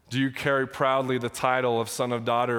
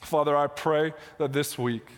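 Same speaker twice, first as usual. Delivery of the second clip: earnest pastor's prayer, pitched higher; 4 semitones higher than usual. Acted. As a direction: lead the room in heartfelt prayer. Pastor leads congregation in prayer.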